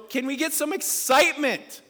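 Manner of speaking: loud, high rallying shout; rousing excitement. Real, not acted. Preacher demands a real cheer from congregation, excited shout. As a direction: shout it up to fire up the crowd. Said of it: "let's go with a real chicken".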